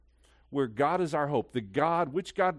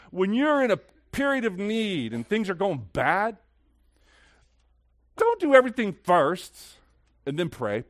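high, loud pulpit exclamation; raised, forceful sermon voice; impassioned preaching; 7 semitones higher, 7 dB louder than usual. Real, not acted. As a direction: preach it at full force, pitched up.

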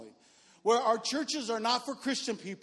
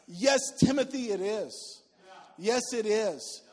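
emphatic affirmation; 2 semitones higher, 4 dB louder, slower than usual. Real, not acted. Preacher exclaims to congregation.

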